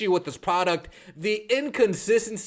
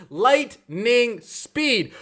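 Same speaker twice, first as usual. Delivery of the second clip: drawn out; 2 semitones higher, much slower than usual. Shown as a demonstration. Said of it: "slowed repeat".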